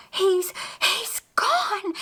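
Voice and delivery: high, slow, breathless gasps; breathless and frantic; gasping, out of breath. Acted. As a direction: gasp it out, winded and shaken. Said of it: "she gasped between breaths".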